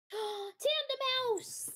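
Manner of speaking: thrilled discovery, drawn out; excited gasp. Real, not acted. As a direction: gasp then a slow, high squeal. Excited spotting a creature.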